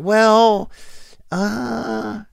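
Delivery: very high, hesitant squeak; wavering reconsideration. Retold or imitated. Comic reconsidering the lady's offer, high-pitched hesitation. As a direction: go high and hem and haw.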